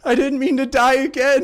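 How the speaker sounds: high, loud, wailed apology; hammed-up crying plea; tearful apology. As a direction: wail it as a weepy excuse. Kadal tearfully apologising in reunion.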